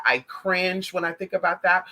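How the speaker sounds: ordinary talk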